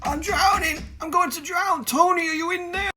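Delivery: panicked high voice. Acted. Drowning in the tank.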